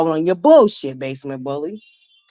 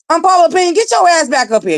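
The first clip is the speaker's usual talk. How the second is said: loud and playful; calling out; 9 semitones higher, 3 dB louder than usual. Real, not acted. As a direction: call her back up, teasing. Calling co-host back up on stage.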